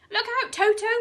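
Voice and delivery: high, loud urgent shout; panicked hippo shouting; shouted warning. A narrator's reading. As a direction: yell out a warning to the children. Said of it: "shouted Humpy Rumpy".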